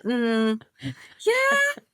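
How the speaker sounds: imitating a husband's high, half-hearted yeah; mock unimpressed husband. Wife mimicking her husband's lukewarm feedback, mock high. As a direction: lightly parody a polite but unconvinced reply. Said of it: "it was a bit like".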